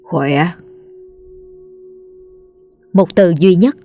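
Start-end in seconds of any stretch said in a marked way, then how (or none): 0.0-0.5 low, choked one-word reply; strained, low croak of an answer; choked in the throat. A narrator's reading. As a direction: force it out from a blocked throat. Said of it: "nghe như bị nghẹn nghẹn ở cổ họng".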